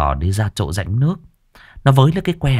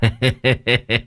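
short laugh; 2 semitones higher than usual. Real, not acted. Narrator laughs at the joke.

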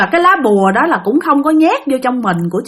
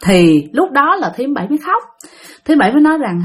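usual speaking voice

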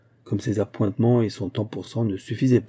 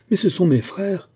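high-pitched, distressed self-defence; flustered protest; 9 semitones higher, 3 dB louder than usual. A narrator's reading. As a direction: protest in dismay, pitch jumping up. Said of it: "elle balbutia". (protestation affolée, voix aiguë)

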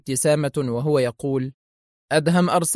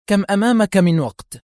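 tense, higher-pitched demand; edgy; 6 semitones higher, 3 dB louder than usual. A narrator's reading. Impatient boss asks programmer for time left, edgy. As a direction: ask it with a nervy, raised voice. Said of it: "عادت عصبيتها إلى الظهور".

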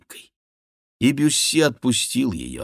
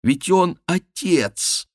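high, slow, emphatic objection; pleading indignation; shocked protest; 10 semitones higher, 3 dB louder, much slower than usual. A narrator's reading. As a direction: stretch the words out high, aghast. Diana protests at the suggestion.

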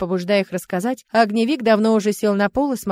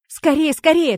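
higher, louder hurrying call; urging haste; 7 semitones higher, 6 dB louder, slower than usual. A narrator's reading. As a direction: hurry everyone along urgently. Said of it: "торопила всех и Яри".

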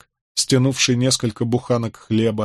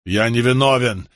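ordinary delivery, nothing marked

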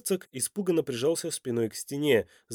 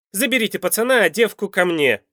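loud, raised command; angry shouted order; 8 semitones higher, 8 dB louder than usual. A narrator's reading. Villain orders his guards, shouting angrily. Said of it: "Зло выкрикнул Михаил".

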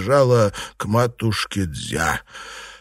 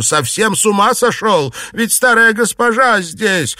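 high, loud indignant scolding; outraged high-pitched reproach; scandalised shouting; 11 semitones higher, 5 dB louder than usual. A narrator's reading. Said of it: "закричали госпожи Син и Ван".

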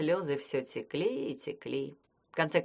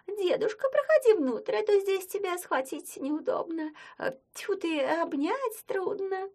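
much higher rubbery squeaky voice; squeaky fake girl voice; 14 semitones higher than usual. Werewolf keeps girl voice.

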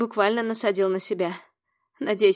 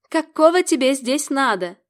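loud, higher angry demand; angry shout; 4 semitones higher, 7 dB louder than usual. Acted. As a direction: snap at the intruder. Heroine snaps at intruder.

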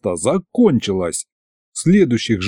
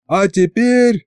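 high-pitched party host's call; yelled announcement; 7 semitones higher, slower than usual. A narrator's reading. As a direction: announce it to a crowd, pitch raised. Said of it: "заорал я". (выкрик ведущего, высоким голосом)

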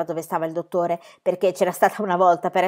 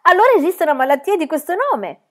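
eager and higher, louder; all excited; 9 semitones higher, 6 dB louder than usual. A narrator's reading. Pippi grabs the doctor's arm, eagerly excited. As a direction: burst out with eager hope. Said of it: "tutta eccitata".

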